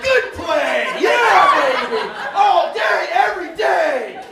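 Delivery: high, loud hype shouting; cheering classmates on like a hockey fan; sideline cheer. Retold or imitated. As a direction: hype them up like a coach. Cheering actors like a coach.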